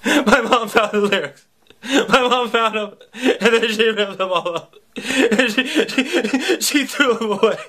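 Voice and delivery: hammed-up crying kid; imitating bawling friend. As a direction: blubber it out half-laughing. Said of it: "He just started bawling".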